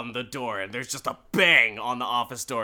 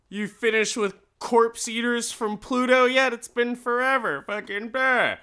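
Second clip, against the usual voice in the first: high, louder teasing voice; mocking coworker; 9 semitones higher, 5 dB louder than usual. Coworker ribbing Corman about his overdue comic, high mocking voice. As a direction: needle him like an obnoxious coworker.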